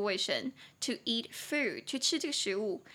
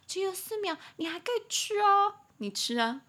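high, sarcastic dare; pitched-up taunting; mocking challenge; 9 semitones higher than usual. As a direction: dare them to eat it. Acted challenge to five-second-rule believer.

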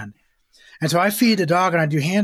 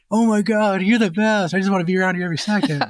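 a hint of a devoted dog gushing fast; dog's adoring voice; 3 semitones higher than usual. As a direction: trainer voicing his hand-fed dog's devotion. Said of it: "your dog is gonna be like".

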